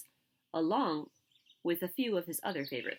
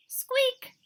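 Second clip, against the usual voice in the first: tiny high squeak like a mouse; 5 dB louder than usual. Acted. Narrator squeaks for Mister Mouse.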